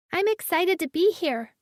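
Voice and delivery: put-on high, bright child chirp; chirpy little girl. A narrator's reading. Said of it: "she chirped".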